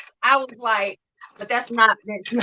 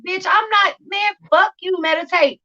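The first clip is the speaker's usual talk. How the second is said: mock outraged scoff; 8 semitones higher than usual. Retold inner outrage at advice.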